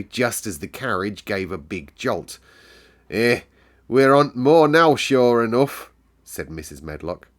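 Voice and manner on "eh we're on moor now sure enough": loud Yorkshire exclamation; Yorkshire housekeeper. Acted. Medlock tells Mary as the carriage jolts, Yorkshire. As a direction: announce the moor in Yorkshire.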